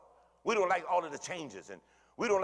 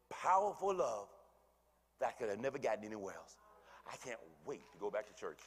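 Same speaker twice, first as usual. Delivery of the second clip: ordinary delivery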